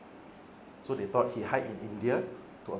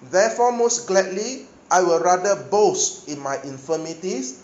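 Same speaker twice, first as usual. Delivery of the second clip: full-force preaching; 4 semitones higher, 7 dB louder than usual. Acted. Preacher drives Paul's verses home to congregation, loud preaching.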